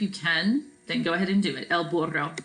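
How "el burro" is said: burro with the double R rolled. Shown as a demonstration. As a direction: roll the RR in "burro". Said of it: "two R's are rolled".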